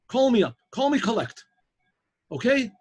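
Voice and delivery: insistent. Retold or imitated. Professor urges driver to call.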